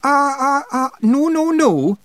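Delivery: very high, loud Feegle objection; agitated wee Scots rogue protesting; frantic protest. A narrator's reading. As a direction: butt in excitedly, high and loud. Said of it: "jumping up and down".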